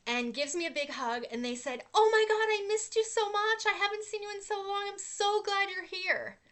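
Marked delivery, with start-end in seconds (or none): 1.9-6.4 high-pitched, effusive mimicry of delight; gushing greeting. Formerly challenging acquaintance running up for a hug, imitated gushing greeting. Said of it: "comes running over to me".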